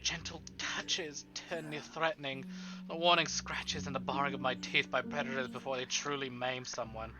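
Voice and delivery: ordinary talk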